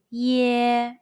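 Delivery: "ye" drawn out long and level; prolonged ye. Shown as a demonstration. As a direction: stretch the vowel of "ye" and hold it. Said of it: "but it's prolonged".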